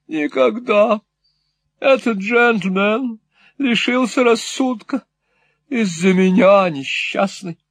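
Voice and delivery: lightly voiced dramatic matron; Mrs Nickleby lamenting. A narrator's reading. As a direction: insist it is all her fault.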